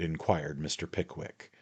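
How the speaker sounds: ordinary delivery, nothing marked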